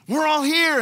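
high, loud urgent shout; Paul yelling out. Retold or imitated. Paul shouting to the jailer to stop him, high and loud. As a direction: shout to stop a man harming himself. Preacher repeats Paul's shout.

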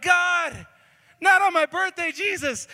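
hammed-up birthday despair; mock desperate cry. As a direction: wail it at God like it's a tragedy. Despairing cry over chicken soup.

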